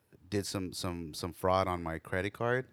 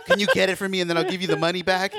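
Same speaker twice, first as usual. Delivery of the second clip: put-on high, louder kid begging; pleading kid; 7 semitones higher, 5 dB louder than usual. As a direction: plead like a kid who needs it now. Son pleading for Fortnite skin.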